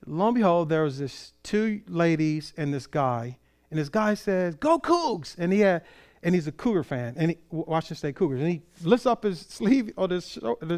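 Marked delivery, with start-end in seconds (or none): 4.5-5.4 high, mock team cheer; fan's cheer. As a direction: imitate a keen fan rooting for the team. Retold sports fan cheering.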